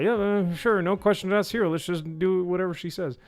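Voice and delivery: high, sarcastic dopey villager voice; mocking villagers. Mocking villagers who accept the orc head, high sarcastic. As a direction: make the villagers sound dim. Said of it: "they just go".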